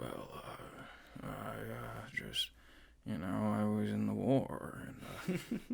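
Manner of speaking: hoarse, breathy whisper; raspy whisper. Shown as a demonstration. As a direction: rasp it out in a whisper. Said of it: "Do you like a little raspy voice and just, like, whisper?".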